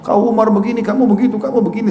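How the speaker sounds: high-pitched mock wifely tirade; nagging angry wife. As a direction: imitate a wife rattling off complaints. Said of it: "Istrinya marah, ngoceh-ngoceh". (menirukan istri ngomel bernada tinggi)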